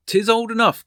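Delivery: high, loud leering cry; drunken cry. A narrator's reading. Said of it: "cried Monmouth".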